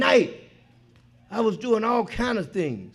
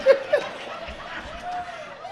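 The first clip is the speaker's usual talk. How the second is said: real laugh, high and breaking up. Real, not acted. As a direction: let the laugh burst out high. Laughs at his own story.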